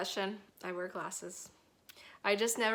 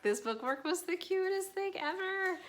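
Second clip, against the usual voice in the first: excited gushing; 10 semitones higher than usual. Real, not acted. Gushing about bookmark again.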